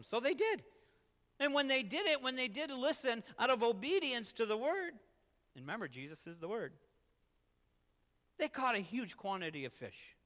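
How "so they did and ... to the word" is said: loud, high sermon swell; fervent preaching. Real, not acted. Preacher telling how they did listen, fervent preaching. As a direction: drive the point home to a congregation.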